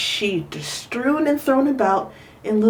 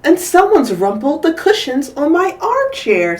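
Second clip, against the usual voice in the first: fussy Missus Chan; 6 semitones higher than usual. Acted. Mother complaining in story.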